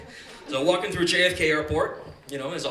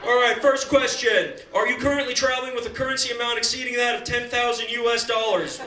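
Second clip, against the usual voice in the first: interrogating officer; 5 semitones higher, 3 dB louder than usual. Retold or imitated. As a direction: grill the kid with deadpan official questions. Officer asks interrogation questions.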